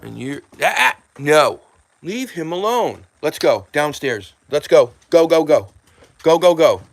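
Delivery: loud, high commands; shouting at a dog. Real, not acted. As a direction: herd the dog out loudly. Shooing the dog downstairs.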